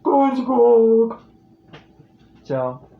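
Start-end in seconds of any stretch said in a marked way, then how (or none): 0.0-1.2 loud, higher, hands-cupped call; hollow Patrick call. Shown as a demonstration. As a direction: cup your hands for a hollow sound. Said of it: "use your hands".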